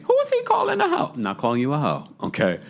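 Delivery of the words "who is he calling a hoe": high, indignant squeak of a question; mock outraged Adriana. Imagined Adriana outraged at the host's words. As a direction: pitch it way up in mock offense. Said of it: "she's gonna think".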